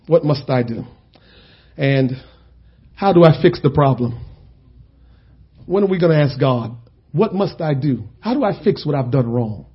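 low, hushed preaching; preacher ending quietly; soft closing sermon. Real, not acted. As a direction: bring it down to a tender close.

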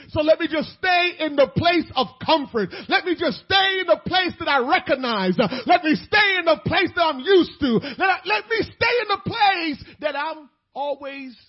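loud, high, building repetition; full-force preaching. Acted. Preacher mocking comfort-seekers, loud and high.

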